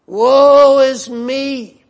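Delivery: slow loud cry of woe. Acted. Preacher voicing Isaiah's despairing cry of woe. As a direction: draw out woe is me, loud and high.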